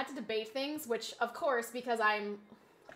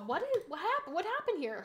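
usual speaking voice

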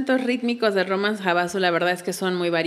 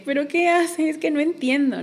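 lightly acted confusion, pitched higher; mock bewilderment at the teacher's moves; half-playful puzzled self-quote; baffled beginner; 8 semitones higher than usual. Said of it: "y era como de".

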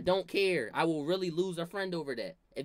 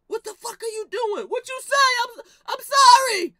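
shrill mock-crying cousin caught by grandma, much higher and louder; high crying shout; 15 dB louder than usual. Cousin caught dicking the soda, mock-crying shout. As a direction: wail it like a busted kid.

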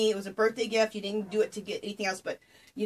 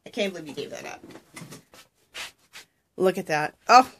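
ordinary delivery

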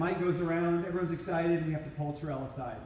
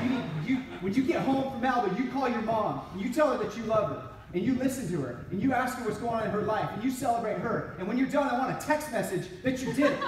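higher, louder fired-up commands; zealous friend laying out orders, louder; fervent instruction; 7 semitones higher, 3 dB louder than usual. Retold or imitated. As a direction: preach it at him, all in. Terrell giving Jeff orders.

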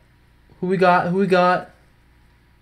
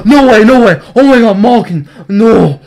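gasping, high, loud disbelief; can't believe the pull; stunned disbelief; 7 semitones higher, 6 dB louder than usual. Real, not acted. Big pull surprise.

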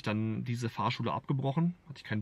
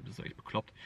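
whispered self-reproach; 10 dB quieter than usual. Retold or imitated. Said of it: "wo man sich denkt".

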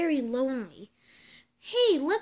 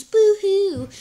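mock crying; 5 semitones higher, 8 dB louder than usual. Doctor's sad news.

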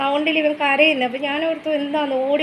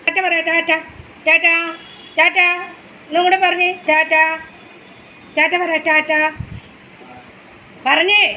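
higher, louder sing-song coaxing; 5 semitones higher, 4 dB louder, faster than usual. Real, not acted. Mother coaxing baby to say ta-ta to daddy, sing-song.

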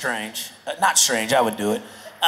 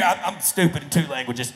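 ordinary talk, nothing marked

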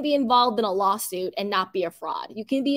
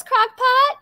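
excited squeal; 13 semitones higher, 7 dB louder than usual. Real, not acted. Excited reaction to a comment.

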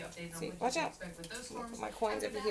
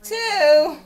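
loud, higher announcement of the roll; called out loud; 7 semitones higher, 12 dB louder than usual. Real, not acted. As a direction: call the number out proudly. Calling out dice roll.

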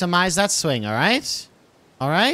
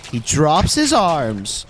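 ordinary delivery

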